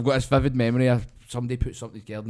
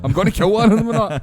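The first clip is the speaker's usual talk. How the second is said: higher, louder hammed-up old man rant; mock angry threat; 9 semitones higher, 9 dB louder than usual. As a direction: play a furious neighbour. Voicing angry old neighbour.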